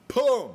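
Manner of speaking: high loud mock boom; cannon boom. Dad imitating the glitter cannon blast for viewers. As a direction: pop it like a cannon.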